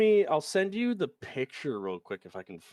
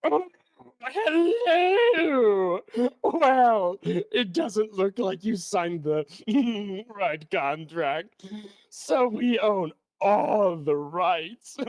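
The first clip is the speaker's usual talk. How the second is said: high, drawn-out oily executive voice; smarmy greedy corporate sack voice; slow, oily corporate villain savoring the contract; sleazy corporate voice; 4 semitones higher, much slower than usual. Acted. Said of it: "my favorite voice I do".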